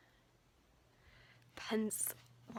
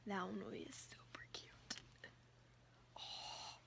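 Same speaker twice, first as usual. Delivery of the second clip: whispering; 9 dB quieter than usual. Real, not acted. Whispering while choosing clothes.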